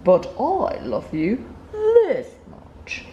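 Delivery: slow, tender big-hare voice; slow and loving, outdoing the little one; lightly voiced fond father; gentle parent hare. A narrator's reading. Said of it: "Big nut brown hare had even longer arms".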